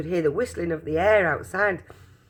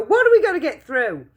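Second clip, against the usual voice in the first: alarmed shout; 10 semitones higher, 5 dB louder than usual. Acted. Grandpa Joe continues.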